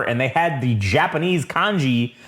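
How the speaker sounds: ordinary talk, nothing marked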